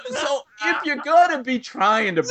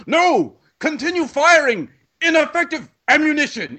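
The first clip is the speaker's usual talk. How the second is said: higher, louder hammy captain order; stubborn captain; 8 semitones higher, 3 dB louder than usual. Retold or imitated. Captain refusing the big gun, hammed-up and loud. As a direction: play a pompous captain refusing. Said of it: "And the captain goes".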